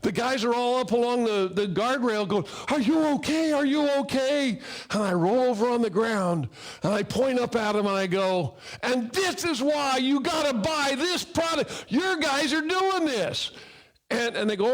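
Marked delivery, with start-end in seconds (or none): 2.7-4.6 worried calling out. Retold or imitated. Workers calling down from guardrail. 8.8-13.5 high shouted sales pitch, the end drawn out slowly; triumphant shout. Retold or imitated. Shouting up from the ground.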